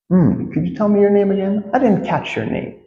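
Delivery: high-pitched polite asking; polite request. Shown as a demonstration. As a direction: ask in a high, courteous voice.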